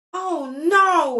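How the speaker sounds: high, loud dismayed cry; kind of loud. Acted. Junie B sees the bus coming and cries out loud. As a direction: wail it in dread. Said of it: "I said kind of loud".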